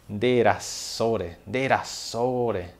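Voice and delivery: "Derassore" very slow, each syllable stretched; slow drawn-out Guarani. Shown as a demonstration. Teacher drawls derassore for the class to copy. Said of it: "e ela fala assim, ó".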